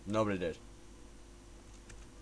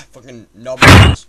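loud furious outburst; angry shout; 2 semitones lower, 14 dB louder than usual. Real, not acted. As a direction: bark it out in anger. Shouting at the game.

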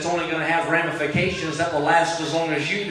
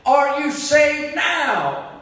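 high, slow, loud call across the water; drowning man shouting over the sea; cried out; 12 semitones higher, 8 dB louder, much slower than usual. Retold or imitated. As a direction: call it out over the roar of the waves. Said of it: "that man cried".

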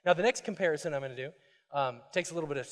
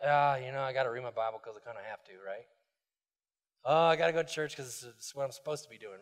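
low, fast, grudging mumble; mock the dutiful bored believer; reluctant churchgoer; 6 semitones lower than usual. Pastor mocks churchgoers who only go from duty, grudging mumble. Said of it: "we're like".

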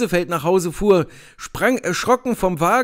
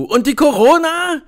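higher, louder demanding call; 7 semitones higher, 4 dB louder than usual. A narrator's reading. Narrator calling to the officer about the corona, urgent. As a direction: press him from a distance. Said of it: "rufe ich ihm zu".

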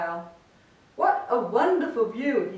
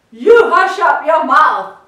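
loud furious howl; 5 semitones higher, 14 dB louder than usual. A narrator's reading. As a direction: howl him down in rage. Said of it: "howled the mighty King Yertle".

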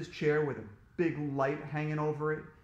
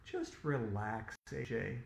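slow, low, soft calming voice; calm the child down, drawing it out; soothing; 5 semitones lower, 5 dB quieter, slower than usual. A narrator's reading. Dentist tells AJ to relax, soothing.

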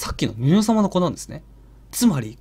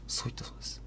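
hushed whisper; 19 dB quieter, faster than usual. Real, not acted. 怪談の締めのささやき.

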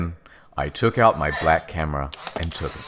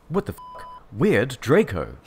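high-pitched startled question; 6 semitones higher than usual. A narrator's reading. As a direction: open high, as if taken aback.

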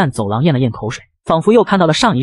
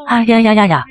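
startled cry; 6 semitones higher than usual. A narrator's reading. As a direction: jump out of your skin. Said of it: "他吓得大惊失色".

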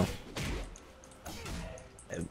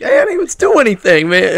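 whiny protest; 11 semitones higher, 8 dB louder than usual. Retold or imitated. Voicing Landon's protest.

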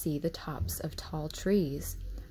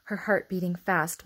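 plain reading voice

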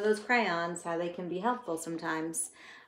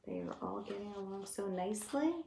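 soft aside; 10 dB quieter, faster than usual. Real, not acted.

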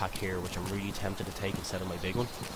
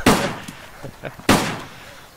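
laughing; 10 dB louder than usual. Real, not acted. Laughing at the loud gun.

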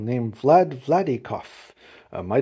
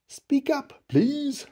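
high, drawn-out request; polite high-pitched appeal; Horton; 11 semitones higher, much slower than usual. A narrator's reading. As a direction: call gently to someone tiny. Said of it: "said Horton".

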